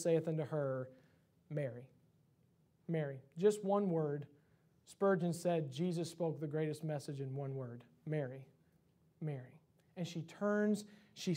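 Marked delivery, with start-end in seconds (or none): 1.5-3.2 low, soft, tender single word; gentle name-calling. Retold or imitated. Jesus to weeping Mary, low and gentle. 8.0-9.6 low, soft, tender single word; gentle name-calling. Retold or imitated. Jesus to weeping Mary, low and gentle.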